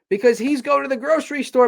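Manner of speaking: high, loud exasperated outburst. Acted. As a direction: blurt it out in frustration. Ranting skit climax.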